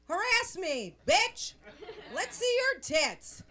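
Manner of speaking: loud, high, hammed-up catcall; demanding shout. Woman harassing construction worker Julio, mock aggressive shout. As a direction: holler it as a brash demand.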